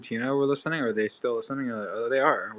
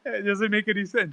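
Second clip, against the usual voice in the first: laughing disbelief; 7 semitones higher than usual. Retold or imitated. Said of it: "So I was like".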